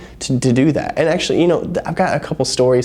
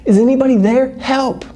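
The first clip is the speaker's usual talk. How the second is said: high, panicked calls for help; yelling for help; 13 semitones higher than usual. Acted. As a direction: cry out from the cliff edge. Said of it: "he just yells".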